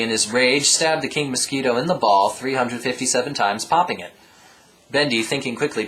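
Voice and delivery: ordinary delivery, nothing marked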